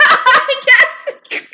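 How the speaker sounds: high, loud burst of laughter; laughing. Real, not acted. Laughing at ex's blunt words.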